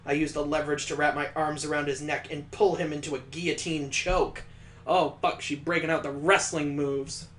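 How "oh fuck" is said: ordinary delivery, nothing marked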